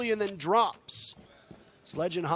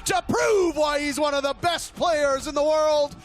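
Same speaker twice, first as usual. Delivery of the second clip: excited shout; 8 semitones higher, 6 dB louder, slower than usual. Real, not acted. Caster hypes player's clutch.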